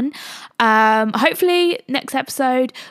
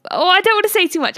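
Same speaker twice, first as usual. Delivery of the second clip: quick, high, eager catch-yourself; blurting it out, then stopping; excited blurt; 7 semitones higher, faster than usual. Real, not acted. Catching herself teasing next guest.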